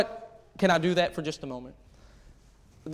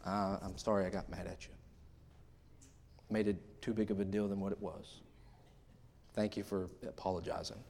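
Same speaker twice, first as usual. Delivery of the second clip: quiet, low apologetic voice; wife's quiet apology, softer and lower; 8 semitones lower, 16 dB quieter than usual. Retold or imitated. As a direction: gently imitate the wife's apology. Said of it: "she'll come back and she'll say".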